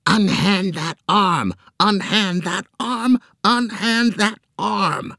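high, slow repeated monster shriek; shrieking squid. A narrator's reading. Squid monster shrieking at Joe, high and slow. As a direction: screech it over and over.

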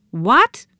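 loud, high shocked cry; surprised cry. A narrator's reading. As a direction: shout it in disbelief. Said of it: "cried the twins in unison".

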